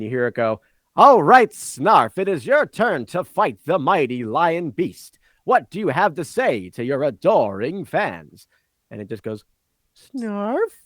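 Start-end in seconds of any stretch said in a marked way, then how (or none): 1.0-8.5 booming announcer, much higher and louder at the start; game show announcer. Acted. Floating microphone introducing the next contestant, booming announcer. 9.9-10.7 high, rising squeak of a confused creature; a baffled little creature's one-word question; puzzled creature. Acted. Snarf creature answering the announcer, puzzled squeak.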